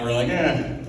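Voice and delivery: usual speaking voice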